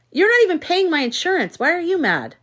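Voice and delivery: a retold mild outburst, high and sharp; mock angry yell. Retelling how the doctor yelled at her, mock angry and high. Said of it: "He was, yelled at me".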